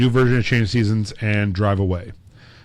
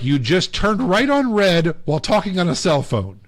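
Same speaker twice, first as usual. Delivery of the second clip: high, lecturing mock cop voice; imitating a lady cop; 8 semitones higher than usual. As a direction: list the offences like a stern officer. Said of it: "And she's like".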